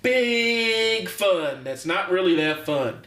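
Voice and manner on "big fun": "big" held long and high; sarcastic. Retold or imitated. Coach contrasting real fun with sitcom fun, sarcastic. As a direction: draw it out with mock enthusiasm. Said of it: "sarcastically saying".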